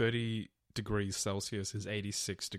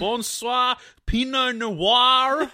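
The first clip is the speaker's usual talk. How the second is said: put-on much higher, louder, very slow French accent; mock French; 13 semitones higher, 11 dB louder, much slower than usual. Co-host joining the joke in a high, slow mock French accent. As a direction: sing it out like a cartoon Frenchman. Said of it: "that's what I imagine".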